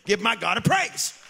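"Give my God a praise" shouted with a rise on "praise"; "Give my God a praise" called out loud, rising on "praise". Acted. Calls for praise again louder.

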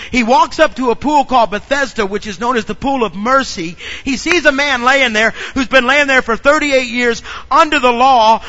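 rising sermon delivery; fervent preaching. Acted. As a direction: preach it out to the congregation. Preacher builds the Bethesda story.

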